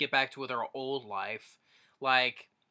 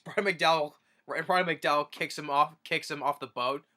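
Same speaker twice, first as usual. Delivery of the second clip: normal talking voice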